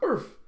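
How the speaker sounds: loud, high "arf"; dog bark. A narrator's reading. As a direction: imitate a dog barking sharply and high. Dog Bandit barks in story.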